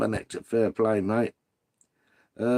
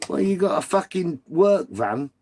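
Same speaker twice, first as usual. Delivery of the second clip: normal talking voice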